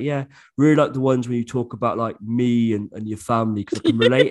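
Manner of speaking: voicing a younger brother; brother's voice.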